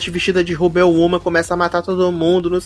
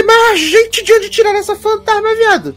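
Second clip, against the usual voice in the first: much higher, mock outraged disbelief; incredulous. Viewer reacting to a ghost appearing from nowhere, shrill disbelief. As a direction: shriek it like you can't believe the writers.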